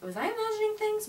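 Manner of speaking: high, incredulous self-question; baffled fan doubting her eyes; puzzled. Retold or imitated. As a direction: pitch it way up in disbelief. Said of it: "So I was like".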